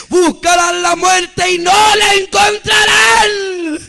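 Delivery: impassioned preacher's climax, long drawn out; climactic prophetic cry. Retold or imitated. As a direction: wail out the warning, stretching the words. Repeated pronouncement at climax.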